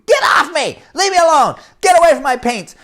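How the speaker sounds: put-on high, loud boyish yell; fighting yell. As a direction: shout at your brother to get off. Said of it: "went from happy little boys painting to".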